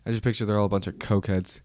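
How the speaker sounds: ordinary delivery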